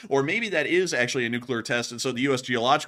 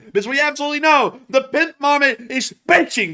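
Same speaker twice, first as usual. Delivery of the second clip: excited; 11 semitones higher, 7 dB louder than usual. Pretend. Hyped voice about marmot.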